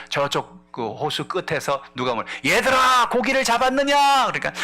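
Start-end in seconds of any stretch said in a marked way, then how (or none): 2.5-4.3 high, loud call across the water. Acted. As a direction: call out to the boat from afar. Jesus calls from the shore. (멀리서 크게 부르는 목소리)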